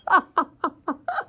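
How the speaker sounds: high bursting laugh; burst of laughter. Real, not acted. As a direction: let the laugh break out. Laughing at co-host's gaffe.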